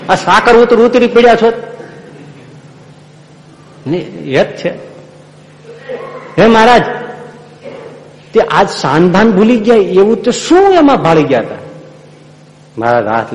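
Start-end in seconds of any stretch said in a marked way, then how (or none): 0.1-1.5 laughing scold. Acted. Scolding, amused, at overeating. 6.4-11.5 astonished disciple. Acted. Disciple exclaims to Maharaj.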